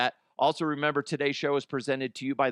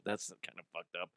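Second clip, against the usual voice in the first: ordinary delivery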